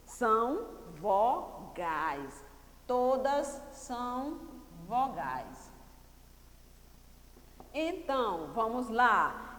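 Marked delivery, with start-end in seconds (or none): 0.1-5.7 very slow, with gaps between words; slow dictation. Shown as a demonstration. Teacher dictating the answer to the class, slow and spaced out. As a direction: stretch each word out for someone writing.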